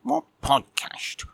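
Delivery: high, squeaky grumpy cat puppet just woken; a sleepy, put-out little puppet admiral; cheeky puppet cat. Acted. Puppet cat grumbling at Mike for waking him, squeaky.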